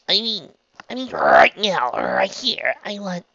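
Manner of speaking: high, halting, stretched confession; flustered. A narrator's reading. Trixie struggles to admit desire, flustered. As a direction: drag the words out, trailing into breath.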